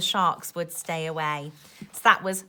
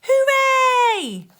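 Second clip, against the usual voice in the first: high, louder joyful hooray; cheering shout; 11 dB louder than usual. Acted. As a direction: cheer as the sharks flee. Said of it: "the pirates just shouted".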